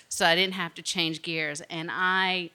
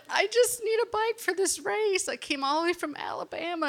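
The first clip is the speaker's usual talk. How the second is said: much higher and faster, a cry in the voice; pleading, near tears; 12 semitones higher than usual. Retold or imitated. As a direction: beg him for a bike like it's desperate. Begging the bike shop owner.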